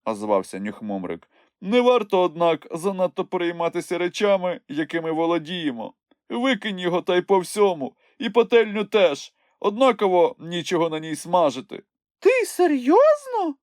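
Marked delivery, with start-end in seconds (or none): 1.6-11.8 lightly raised, easy wanderer's voice; a relaxed traveller giving advice; Snufkin's calm voice. A narrator's reading. Snufkin advises throwing tent. 12.2-13.5 high squeaky little creature, shocked; a tiny creature who can't believe it; astonished squeak. A narrator's reading. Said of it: "вражено запитав Чмих".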